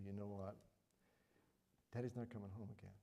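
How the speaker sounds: soft and quick, breaking bad news; hushed and tender, delivering the worst news; a soft voice for a grieving little girl; gentle, sorrowful telling.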